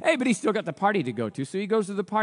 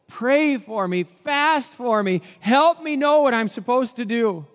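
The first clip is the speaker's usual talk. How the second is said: urgent plea; 7 semitones higher, 6 dB louder, slower than usual. Acted. Esther pleads with Mordecai, people.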